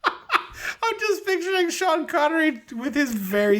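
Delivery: high, helpless giggly delivery; laughing while talking. Real, not acted. Picturing Connery as a leprechaun, cracking up while talking. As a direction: squeeze the words out through laughter.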